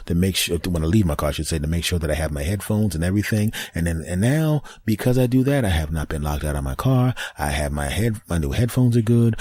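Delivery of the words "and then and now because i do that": ordinary delivery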